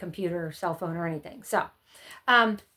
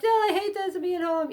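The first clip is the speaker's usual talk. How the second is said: put-on whiny kid; 9 semitones higher than usual. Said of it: "some might be like".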